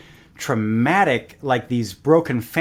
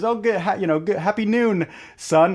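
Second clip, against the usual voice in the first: higher-pitched, lightly acted hello; cheery greeting; 7 semitones higher than usual. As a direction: greet the sun as a joke character. Midday greeting in the joke.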